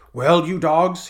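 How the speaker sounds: loud roar at the crowd. Acted. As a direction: roar it at the pirates. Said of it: "he roared".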